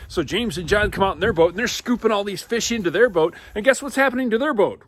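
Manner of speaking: high, loud breathless narration of the catch; animated, building narration; excited storytelling. A narrator's reading. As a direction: tell it like it's happening right now.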